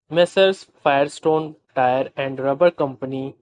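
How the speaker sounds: usual speaking voice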